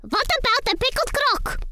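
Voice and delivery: high chirpy bug voice; a lively little cockroach. Acted. Colin Cockroach suggests the pickle crock, high and chirpy.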